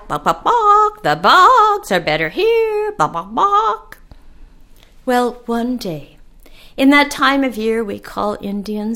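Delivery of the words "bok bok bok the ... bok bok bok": higher clucking hen reply. Acted. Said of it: "And she would reply".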